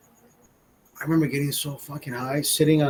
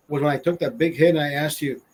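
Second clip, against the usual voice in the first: ordinary delivery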